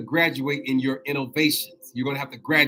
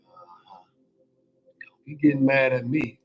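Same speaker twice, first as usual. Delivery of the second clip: normal talking voice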